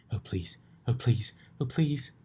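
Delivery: rising desperate pleading; mounting plea. A narrator's reading. Rarity begs over her chest, rising desperately.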